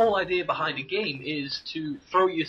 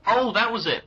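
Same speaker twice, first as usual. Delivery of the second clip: sudden recall; 7 semitones higher, 6 dB louder than usual. Real, not acted. Suddenly remembers the topic.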